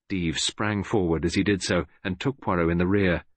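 usual speaking voice

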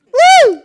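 whooping woo; excited cheer; high loud cheer. Acted. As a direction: let out a joyful whoop. Cheer after cosy quote.

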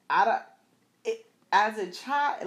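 usual speaking voice

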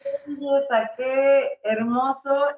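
slow, moved, holding tears in; savoring each word through tears; holding back sobs. Real, not acted. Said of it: "me estoy conteniendo las lágrimas". (conmovida, conteniendo las lágrimas)

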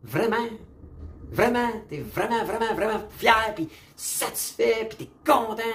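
high-pitched, disbelieving questions; mock incredulity. Viewer reacting to the opposition speeches, high incredulous disbelief. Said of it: "j'étais assis là, puis j'étais comme".

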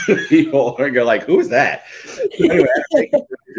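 loud, laughing retelling. Real, not acted. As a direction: laugh through the words. Laughing at explaining old player.

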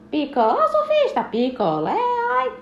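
sung-out cry with high oi; singsong beach vendor call. Acted. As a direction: hawk to the surfer. Vendor calls the surfer.

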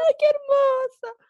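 very high, loud, stretched-out gushing; high, drawn-out mock-tearful coo; retold emotional squeal. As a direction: wail it adoringly at your pet. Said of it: "se puso a llorar y yo".

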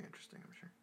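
dry, fast whispered aside. Real, not acted. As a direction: mutter it under your breath. Sarcastic aside while partner rambles.

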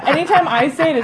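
usual speaking voice

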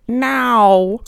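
put-on Norfolk accent cat saying no; drawn out Norfolk "now" for no. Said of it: "which is Norfolk for no".

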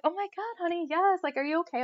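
put-on high, soft, sympathetic girl voice. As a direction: coo over someone upset. Kind girls comforting her.

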